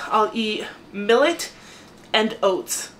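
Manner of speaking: ordinary talk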